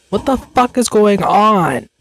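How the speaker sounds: sudden high startled question. Real, not acted. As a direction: jump at a noise, high. Startled by background noise.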